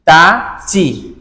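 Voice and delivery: তা, চি drawn out very slowly and separately; slow syllable drill. Shown as a demonstration. Teacher to students, very slow তা চি. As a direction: stretch out তা and চি for the class. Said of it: "সেটা হচ্ছে শুধু চি".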